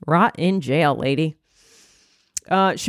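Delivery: ordinary delivery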